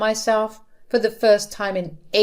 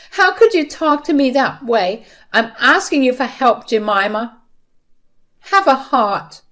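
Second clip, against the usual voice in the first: high, loud, wounded appeal; distraught mother-in-law imploring; desperate pleading; 5 semitones higher, 5 dB louder than usual. A narrator's reading. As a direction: beg her for help, voice raised. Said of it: "Her voice was filled with desperation".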